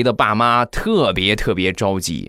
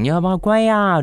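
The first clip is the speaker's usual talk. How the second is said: put-on high, sing-song coaxing of a child; a mother's high, lightly voiced coaxing; 11 semitones higher than usual. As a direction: wheedle the little girl to eat. Wife coaxing daughter to eat.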